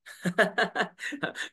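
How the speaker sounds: short amused laugh; quick real chuckle. Real, not acted. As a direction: laugh off the silly complaint. Laughing at the critic.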